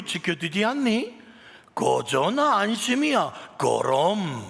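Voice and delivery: high, loud Pyongan dialect with playful rhetorical questions; hearty, amused Pyongan dialect psalm. Acted. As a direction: voice the psalm as a lively Pyongan northerner, pitched well up. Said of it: "평안도 버전".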